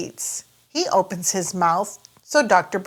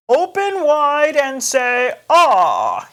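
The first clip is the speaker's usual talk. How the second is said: slower, higher doctor voice; Doctor Brown Bear; 6 semitones higher, 4 dB louder, slower than usual. Acted. Doctor Brown Bear asks George to open wide, slow coaxing voice. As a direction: coax the patient kindly. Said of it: "he says".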